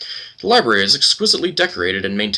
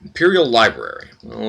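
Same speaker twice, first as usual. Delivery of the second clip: ordinary talk, nothing marked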